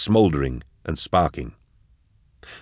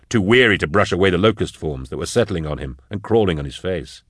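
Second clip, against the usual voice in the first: ordinary delivery, nothing marked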